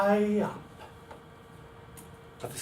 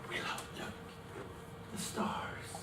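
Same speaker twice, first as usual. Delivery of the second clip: soft whisper; 11 dB quieter than usual. Acted. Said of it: "She said".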